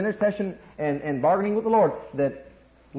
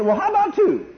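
mock little kid, much higher with a laugh; wheedling child; 12 semitones higher, 4 dB louder than usual. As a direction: play the bargaining kid, pitched way up. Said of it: "they say".

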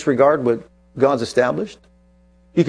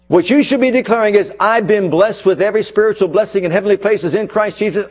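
full-force preaching; 5 semitones higher than usual. Acted. Preacher rousing his congregation against curses, full-force preaching. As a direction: preach it hard to the back row.

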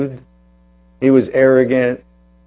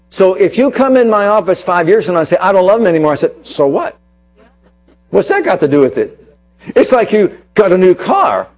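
raised, emphatic sermon voice, punching key words; preaching; 4 semitones higher, 4 dB louder than usual. Acted. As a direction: drive the point home to the congregation. Preacher illustration with car.

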